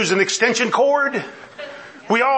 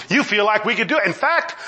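ordinary talk

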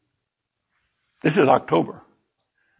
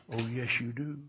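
very soft, hushed inner voice; little voice; 6 semitones lower, 16 dB quieter than usual. Retold or imitated. A little voice answering the preacher's refusal, very quiet. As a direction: barely voice it, like a quiet prompting from within. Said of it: "the little voice said".

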